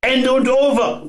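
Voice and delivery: high, full-throated roar. Acted. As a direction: bellow it into the phone. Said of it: "brüllt Max".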